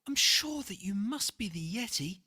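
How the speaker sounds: bubbling over with discovery; excited boy. A narrator's reading. George greets the monster he thinks is the Yeti, eager and loud. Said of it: "shouted George".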